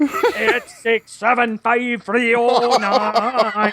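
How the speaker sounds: slow, drawn-out Scottish chorus; Scottish accent. Shown as a demonstration. Host performing the chorus for a laugh, Scottish accent. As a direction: stretch the digits out in a comic Scottish accent. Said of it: "do it with a Scottish accent".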